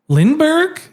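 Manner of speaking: high rising mock psychic; medium probing. Medium calls out the name to the audience, high mock-mystic. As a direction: call the name out questioningly. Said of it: "she's like".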